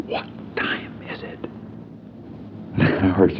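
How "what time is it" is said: slower hushed whisper; whispered again. Retold or imitated. Pressing Howard again at the funeral, slow whisper. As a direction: whisper it slowly and insistently. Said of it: "I said".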